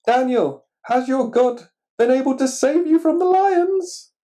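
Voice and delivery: calling out high and loud to Daniel; a worried king shouting into the den; anxious king calling. A narrator's reading. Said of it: "asked the king".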